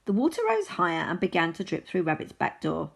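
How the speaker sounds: plain reading voice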